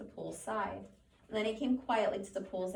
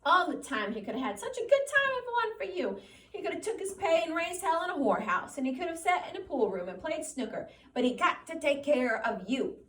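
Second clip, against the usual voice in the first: higher, louder scolding aunt; 7 semitones higher, 5 dB louder than usual. A narrator's reading. Aunt Clara keeps scolding Lenny, high loud scolding. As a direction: scold him without letup. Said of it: "She interrupted him".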